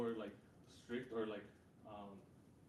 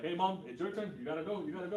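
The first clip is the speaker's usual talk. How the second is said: loud, higher imitation of an urging child; imitating a pushy kid, voice raised; insistent kid; 5 semitones higher, 8 dB louder than usual. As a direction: push your mom to take her turn. Kid pushing his mom to knock.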